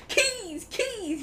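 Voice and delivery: hammed-up excited discovery; mock eureka. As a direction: blurt it like a sudden bad idea. Acting a designer grabbing an idea.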